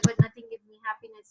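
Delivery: high, fast, whining plea; whiny complaint. Retold or imitated. Rich man whining to Mullah Nasiruddin, high and fast.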